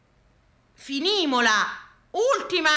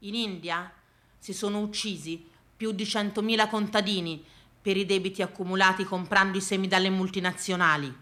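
low, soft, sombre telling; quiet, low-pitched solemnity; grave, hushed; 5 semitones lower, 7 dB quieter than usual. Acted. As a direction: drop right down and tell it gravely. Grave aside about farmers' deaths.

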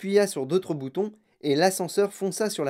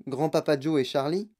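ordinary delivery, nothing marked

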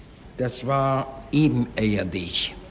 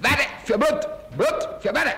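Eichmann's tone; 13 semitones higher than usual. Retold or imitated. Witness recalls Eichmann's tone of voice. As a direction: imitate his clipped commanding tone. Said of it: "Das war sein Stimmfall damals".

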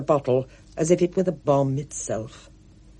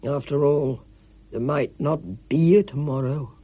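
gloomy Mister Ackley. A narrator's reading. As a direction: let the pessimism ooze. Ackley voiced in the story.

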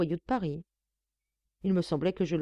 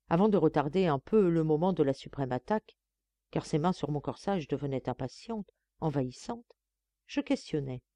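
usual speaking voice

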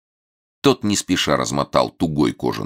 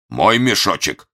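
hasty; 5 semitones higher, 4 dB louder, slower than usual. A narrator's reading. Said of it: "быстро произнёс".

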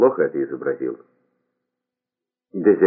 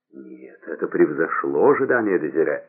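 plain reading voice

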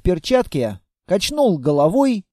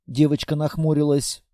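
plain reading voice

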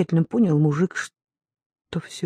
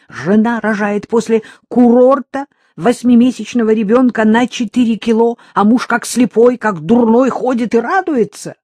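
high and loud, frantic reproach; desperate shout; 6 semitones higher, 8 dB louder than usual. Acted. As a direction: hurl it at him in anguish. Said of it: "крикнула она надрывно, толчками и, кажется, плача".

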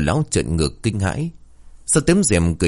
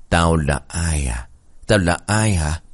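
lightly eerie, drawn-out ghost voice; a hint of words trapped in the throat; ghostly throat voice; 2 semitones lower, slower than usual. A narrator's reading. Sewn-mouthed ghost taunting the old man, lightly eerie throaty voice. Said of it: "giọng nói của người con gái vẫn vang lên trong cổ họng".

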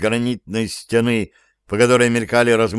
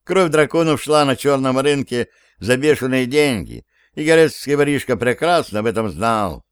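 ordinary delivery

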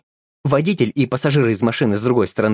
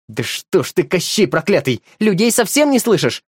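shouted accusation climbing higher; yelling; 7 semitones higher than usual. Acted. Woman shouts at the crossing keeper, furious. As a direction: rage at the stubborn man. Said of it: "закричала Иванова".

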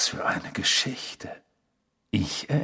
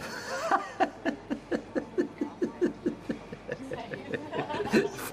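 hohes, hauchiges Auslachen; höhnisches Lachen; 5 dB quieter than usual. Acted. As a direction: lache ihn spöttisch aus. (spöttisches Gelächter)

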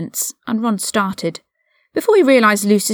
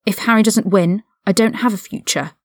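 ordinary delivery

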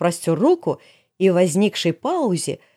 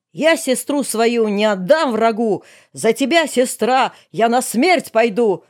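higher, drawn-out epic vow; mock heroic brother; 4 semitones higher, 3 dB louder, slower than usual. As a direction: declaim like a ballad hero. Брат пафосно защищает сестру. (с былинным пафосом, нараспев)

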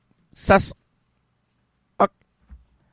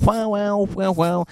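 ordinary delivery

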